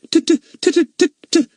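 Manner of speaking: high, loud pretend stammer. A narrator's reading. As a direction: stammer as if scared. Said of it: "stammered Fatty".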